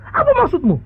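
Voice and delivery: high startled outburst; shocked father. Acted. Father reacts to son's cheeky answer, startled. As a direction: snap back in shock. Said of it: "tentu papanya kaget".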